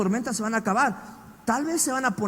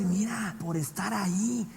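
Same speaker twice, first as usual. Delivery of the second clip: soft, sly, fading tempter voice; devil's whisper; 7 dB quieter than usual. Retold or imitated. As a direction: lure them in quietly like the devil. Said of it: "El diablo te va a decir".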